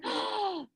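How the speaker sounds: a high, staged gasp of surprise; surprised gasp. Acted. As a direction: gasp as if the picture amazes you. Teacher reveals next picture.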